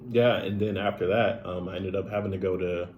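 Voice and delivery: ordinary talk, nothing marked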